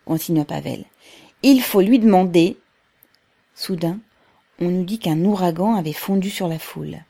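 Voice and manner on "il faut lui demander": loud, insistent oratory; firm public demand. A narrator's reading. Pavel continues addressing the workers, loud and insistent. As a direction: press the demand on the crowd.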